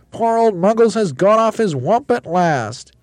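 very high, loud, slow wail; Roly-Poly Bird crying out. A narrator's reading. Bird lamenting monkey leader, crying out. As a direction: draw it out in a high bird squeal. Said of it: "cried the roly-poly bird".

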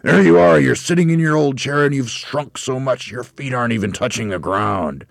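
high, loud accusing shout easing off. A narrator's reading. Husband pointing at wife in her chair, triumphant shout. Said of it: "pointed at her and shouted".

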